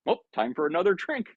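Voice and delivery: high-pitched, light "oops, refill time" voice; breezy self-prompt. Acted. Speaker mimics themselves craving a drink at a party, breezy and high. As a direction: pipe up as if prompting yourself.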